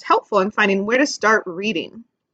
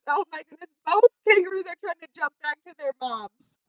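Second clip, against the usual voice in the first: ordinary delivery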